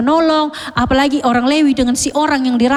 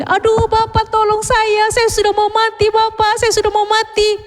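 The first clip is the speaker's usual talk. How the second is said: desperate pleading; 8 semitones higher than usual. Acted. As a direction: beg the priest for your life. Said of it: "Ini orang bilang gini". (memohon dengan putus asa, suara tinggi)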